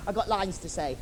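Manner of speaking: ordinary delivery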